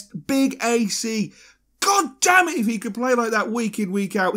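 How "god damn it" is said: exasperated outburst. Real, not acted. Frustrated outburst about Andy Carroll.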